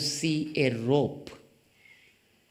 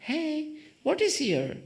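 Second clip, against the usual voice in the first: high playful game voice; teasing question to child. Retold or imitated. Adult teasing a child with paper trick, high playful voice. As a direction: ask it like a grown-up teasing a kid. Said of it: "we show the children".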